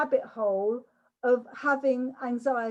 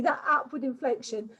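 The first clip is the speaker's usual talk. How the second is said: usual speaking voice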